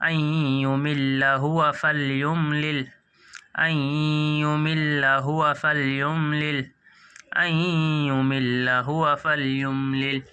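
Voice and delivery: very slow, drawn-out chant; very slow recitation. Shown as a demonstration. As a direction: recite word by word slowly. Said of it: "جلدی پڑھیں گے دوستو۔".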